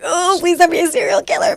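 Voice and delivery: mock nervous plea. Parent hoping for a good kid, mock nervous plea. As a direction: pretend to pray over a random baby. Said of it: "Just put your hand in the bag and like".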